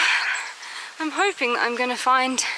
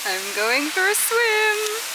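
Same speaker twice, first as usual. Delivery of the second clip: excited; 10 semitones higher, slower than usual. Real, not acted. About to swim.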